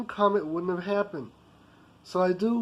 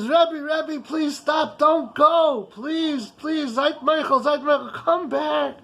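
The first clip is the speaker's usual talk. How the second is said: high, loud, begging him to stop; a frantic boss chasing down the street; desperate pleading call; 5 semitones higher, 4 dB louder, much slower than usual. Retold or imitated. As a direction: call after him, pleading not to leave. Boss running after, begging rabbi.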